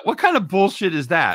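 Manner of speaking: high, loud disbelief; indignant outburst. Real, not acted. Co-host objects to the extra pick, high and indignant. Outraged over co-host's bonus pick.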